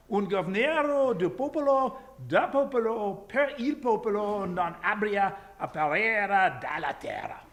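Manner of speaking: loud, high, hammed-up Italian accent; over-the-top Italian oratory; bad Italian accent. Shown as a demonstration. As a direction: proclaim it in exaggerated amateur Italian. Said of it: "my bad attempt to do an Italian accent".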